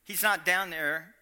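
normal talking voice

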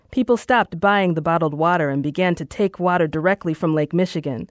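ordinary delivery, nothing marked

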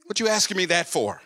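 sharp, defensive retort; with attitude. Retold or imitated. Jailed preacher snaps back at an inmate to be left alone. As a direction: push him away with your tone. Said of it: "say it with enough attitude".

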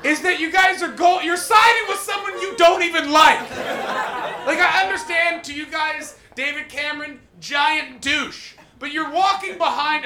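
high, loud ranting yell; ranting shout. Real, not acted. Comic ranting at the audience about independence, loud shout. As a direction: yell it at the crowd in mock fury. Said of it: "This is the fucking problem".

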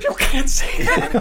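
slow, breathy high squeal through laughter; the room reacting to the slur, mock shock through laughter; laughing mock outrage. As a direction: gasp it out while cracking up. Everybody reacts, speaker laughing.

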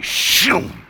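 breathy whoosh of a runner flying past; voiceless rushing shoom; whooshing sound effect. Retold or imitated. Preacher mimics the runner zipping by, whoosh. Kid runs the ball past him.

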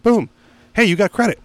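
high, bright clerk imitation; mock clerk voice. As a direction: play the cheery salesclerk offering credit. Store clerk voiced in story.

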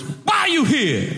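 high, hammed-up inmate demand; prisoner impression. Prisoner questioning a small kid, high mock demand. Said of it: "And the prisoner goes".

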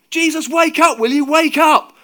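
very loud, high, urgent shout; full-force frantic yelling; desperate yell. A narrator's reading. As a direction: scream to wake a sleeper in a storm. Peter yelling at sleeper in storm.

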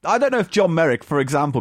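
ordinary delivery

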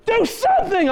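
high-pitched frantic cry for help; panicked wife. Retold or imitated. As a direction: imitate a wife in full panic. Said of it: "She just had a panic attack".

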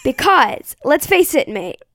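lightly put-on American accent; attempted American accent. Co-host finishes the coach's report, mild American accent. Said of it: "I think her accent will be better".

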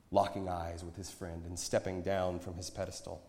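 usual speaking voice

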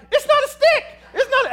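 very high, loud, frightened cry; panicked shriek. Retold or imitated. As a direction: shriek it like you just saw a snake. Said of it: "That's exactly how I sounded".

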